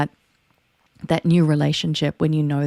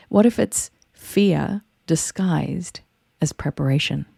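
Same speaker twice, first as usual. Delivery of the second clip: "fear" set apart by pauses, then "disguised as preparation" measured. Real, not acted. First take, posed for effect.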